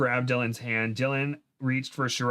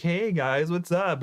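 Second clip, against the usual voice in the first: higher, chirpy casual greeting; friendly monsters; 6 semitones higher, slower than usual. Acted. Flute monsters greet the girls, casual cheer. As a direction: greet them casually in a raised voice. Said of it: "the flute monsters are like".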